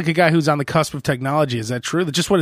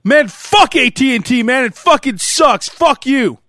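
slow, loud, higher, cursing into the phone; angry caller; 8 semitones higher, 5 dB louder, slower than usual. Pretend. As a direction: play the furious customer, dragging each curse. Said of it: "That's, like, their normal call".

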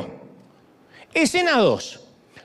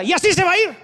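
high, raised call of an offended mother; mother's mock yell; 9 semitones higher, faster than usual. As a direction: call after him, half scolding, half hurt. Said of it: "mi madre me grita". (madre gritándole al hijo que se va sin despedirse, grito fingido)

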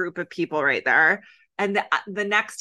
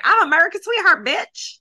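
high, loud mock diva voice; mocking Brittany; 10 semitones higher, 5 dB louder than usual. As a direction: toss it off like a smug reality star. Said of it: "Brittany's like".

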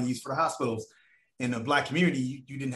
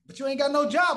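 high, louder mock producer; 12 semitones higher, 4 dB louder than usual. Producer scoffs that he has no job, high and mocking.

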